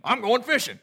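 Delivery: lightly voiced country fisherman; a hint of a country fisherman; mock local fisherman. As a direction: toss it off like a local good old boy. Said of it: "Brown Summit gene".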